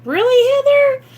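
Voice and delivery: high, loud disbelieving "really?"; amazed at yet another viewer saying it; surprised. Real, not acted. Vlogger to her viewers, loud high surprise at the comment.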